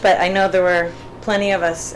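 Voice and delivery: ordinary delivery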